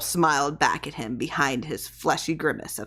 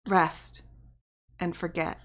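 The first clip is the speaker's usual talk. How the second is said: softer, soothing goodbye; wife hushing her husband, much softer; soft and quiet; 6 dB quieter than usual. A narrator's reading. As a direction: lull him to sleep, softly. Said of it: "she said softly".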